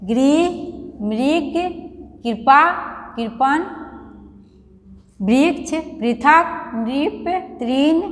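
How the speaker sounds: each word spaced out and clearly said, most ending on a rise, slow on नृप, तृण; carefully read word list. Shown as a demonstration. Teacher reading ri-matra words for children to copy, clear and deliberate. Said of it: "इसको आप बोल बोल कर पढ़ेंगे".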